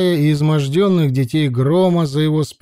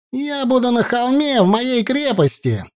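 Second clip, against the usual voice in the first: high call back over the shoulder; shout; 10 semitones higher than usual. Acted. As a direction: call out as you leave. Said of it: "крикнул сын Талмая".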